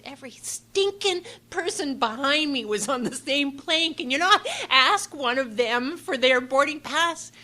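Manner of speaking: high, loud, slow protest; exasperated and fed up; a frazzled traveller snapping at customs; exasperated outburst. Retold or imitated. Exasperated traveller arguing with the customs officer.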